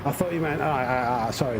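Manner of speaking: ordinary delivery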